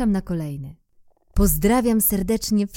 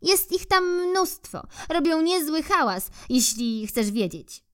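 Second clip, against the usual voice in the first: squirrel; 10 semitones higher than usual. Acted. Squirrel voiced in story.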